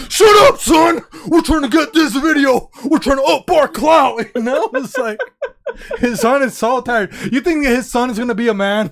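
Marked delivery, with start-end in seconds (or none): 0.1-4.2 loud barking at his son; angry dad. Retold or imitated. Goggins snapping at his son who filmed him. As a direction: snap at the kid to keep filming. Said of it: "David Goggins".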